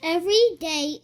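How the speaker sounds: plain reading voice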